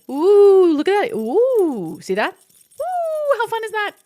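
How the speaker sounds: high, loud, excited oohs; mock distracted delight. Mimicking herself dodging sadness, excited oohs. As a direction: act thrilled by every shiny thing.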